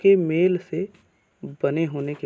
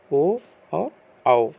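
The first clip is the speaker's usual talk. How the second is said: slow vowel listing; 5 dB louder, much slower than usual. Shown as a demonstration. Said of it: "कौन कौन से".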